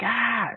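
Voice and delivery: high imitated kiai yell; break yell. Imitating the student's yell as he breaks the blocks. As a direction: mimic the student's yell as he strikes.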